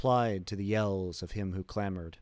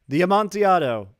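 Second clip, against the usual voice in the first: loud mocking answer; 9 semitones higher, 12 dB louder than usual. Montresor answering Fortunato's laughter loudly. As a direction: throw the word back at him.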